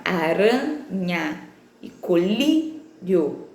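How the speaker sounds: "Aranha" and "colírio" very slow, broken after a long RAN and LÍ; tônica bem marcada. Shown as a demonstration. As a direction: hold RAN in "Aranha" and LÍ in "colírio", then pause before the last syllable. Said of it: "a sílaba tônica é a penúltima". ("Aranha" e "colírio" silabados, com RAN e LÍ bem alongados)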